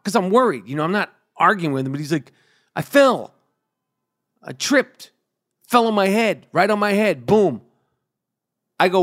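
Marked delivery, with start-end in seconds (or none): 2.7-7.6 higher, lightly voiced old father insisting he fell; a hint of the stubborn old man; imitating dad. Voicing his father's excuse.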